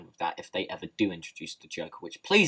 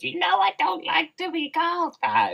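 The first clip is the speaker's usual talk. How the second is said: much higher put-on whiny voice; mock offended Penguin; 7 dB louder than usual. Vlogger mocking Penguin's reply, high whiny squeak. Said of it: "and he goes".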